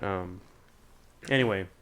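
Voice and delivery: ordinary talk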